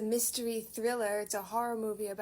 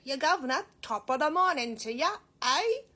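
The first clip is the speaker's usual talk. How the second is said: loud, hammed-up fake British accent, ending high; bad British accent; 5 semitones higher, 9 dB louder than usual. Vlogger mocking how he'd fake being British, bad British accent. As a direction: botch a British accent on purpose, loudly. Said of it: "being like".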